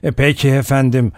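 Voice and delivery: ordinary delivery, nothing marked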